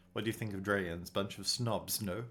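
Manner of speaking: ordinary talk, nothing marked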